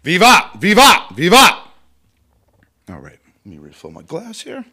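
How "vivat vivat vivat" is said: loud high ceremonial cheer, three times; shouted Vivat. Shown as a demonstration. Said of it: "I'm gonna recite it anyway".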